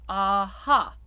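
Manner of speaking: aha with a dark first and a bright second vowel; dark then bright A. Shown as a demonstration. Coach demonstrating dark and bright A to singers. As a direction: aha sung out full. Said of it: "dark A followed by the bright A".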